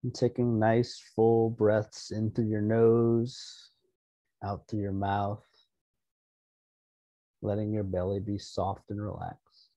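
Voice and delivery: soft-spoken relaxation voice; guided meditation. Real, not acted. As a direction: settle listeners gently, leaving room to breathe. Leads an opening meditation.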